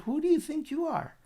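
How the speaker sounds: high-pitched indignant question; indignant challenge. Retold or imitated. Dasan turns on Moshe who saved him, indignant. Retold brother challenges his rescuer.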